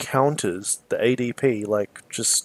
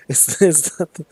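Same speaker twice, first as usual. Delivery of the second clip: laughing; 6 semitones higher than usual. Real, not acted. Laughing at own made-up name.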